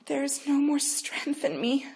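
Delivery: high, spent and a little slow; every word costs her; exhausted. Acted. Dying mother in labor, worn out and high. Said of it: "Rachel breathed heavily".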